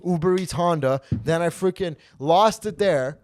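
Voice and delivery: loud, high, ranting yell; furious tirade. Retold or imitated. Yelling at the female instructor to leave him alone, loud rant.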